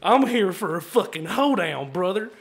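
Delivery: put-on angry drunk Texan. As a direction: declare it like a riled Texan drunk. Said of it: "Angry, drunk Texan".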